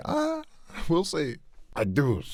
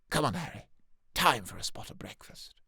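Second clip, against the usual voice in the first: sweet mother; 9 dB quieter than usual. Acted. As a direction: switch to sweetness for Harry. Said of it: "Molly Weasley".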